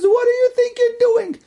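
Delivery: arguing officer. Retold or imitated. Officer challenging SS men at the shul, high indignant argument. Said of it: "they had a whole fight".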